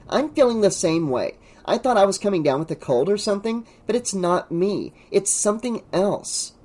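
put-on serious girl character. A narrator's reading. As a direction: pitch up as a schoolgirl sharing her unease. Narrator voices Pam agreeing seriously.

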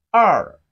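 "R" loud, dropping steeply in pitch; falling tone. Shown as a demonstration. Teacher models Chinese two for learners, loud falling "R". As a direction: hit "R" hard and let it fall. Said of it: "It has the falling tone".

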